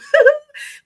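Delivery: high, loud burst of delighted laughter. Real, not acted. Streamer laughing at gifted subscriptions, delighted laugh. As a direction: laugh out with joy. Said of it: "This is exciting".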